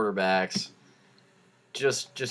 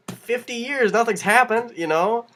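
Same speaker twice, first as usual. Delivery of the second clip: ordinary talk, nothing marked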